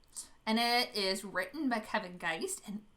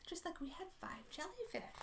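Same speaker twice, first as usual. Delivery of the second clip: whisper; 5 semitones higher, 12 dB quieter than usual. Acted. Storytime aside to children.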